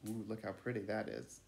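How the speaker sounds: ordinary delivery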